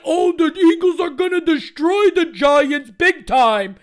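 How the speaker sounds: slow, loud taunting imitation of Aaron Boone; mocking Boone. Mocking Boone's trash talk at the Giants in a slow loud taunt. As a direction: sneer out the boast like a cocky rival fan. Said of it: "come out and be like".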